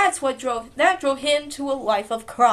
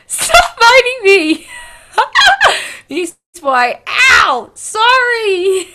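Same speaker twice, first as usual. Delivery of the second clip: loud pained cries, higher; pained yelling; 7 semitones higher, 11 dB louder, slower than usual. Real, not acted. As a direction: yelp and shout to stop the biting. Kids playing, being bitten.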